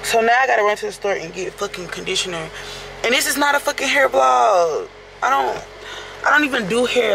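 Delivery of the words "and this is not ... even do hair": higher, exasperated outburst; real irritation. Real, not acted. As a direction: vent the frustration, pitch climbing. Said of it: "and I'm fucking irritated".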